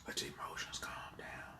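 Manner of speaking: breathy calming whisper with a sigh; whispered reassurance, almost no voice; soothing whisper. Acted. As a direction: hush them gently to calm down.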